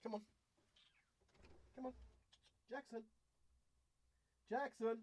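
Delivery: high sing-song call of a pet's name; calling the cat. Real, not acted. Owner calling her cat to come, high call. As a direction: call him in like a pet owner.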